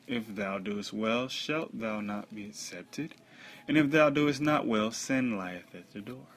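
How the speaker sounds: loud, emphatic Bible reading; solemn scripture reading. Acted. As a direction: proclaim the verses in measured phrases. Reads scripture aloud.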